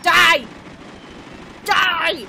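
loud, high yell of Die!; battle yell. Acted. Player yelling at enemies while shooting, loud yell. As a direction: roar it at the enemy.

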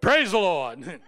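sudden loud praise with a laugh; emphasized praise. Shown as a demonstration. Preacher rousing a dozing elder, loud and laughing. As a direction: shout it out to wake the sleeper. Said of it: "I just emphasize the word".

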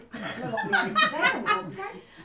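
high, loud burst of laughter; real laugh. Real, not acted. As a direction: burst out laughing at your own story. Laughs at own story.

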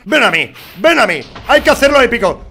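loud, high challenge to the boss; grito desafiante. Real, not acted. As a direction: roar him over. Desafiando al jefe. (grito retador al Wither)

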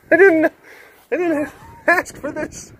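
very high, hammed-up whimper; mock distressed protest. Man pelted with scoops of ice cream, mock distressed protest. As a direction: squeal it like a put-upon victim.

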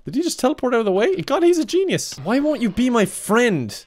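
high excited disbelief; amazed squealing. Real, not acted. Streamer squealing at a teleporting Enderman, amazed. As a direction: marvel at the mob, voice jumping up high. Mob dodges, streamer marvels.

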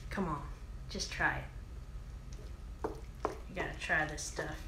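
usual speaking voice

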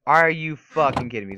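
loud, emphatic disbelief; exasperated outburst. Real, not acted. Gamer attacked already, exasperated outburst. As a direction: hit it hard in disbelief.

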